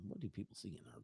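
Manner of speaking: hushed muttered question; muttered aside. Retold or imitated. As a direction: mutter it under breath. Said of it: "I really was like".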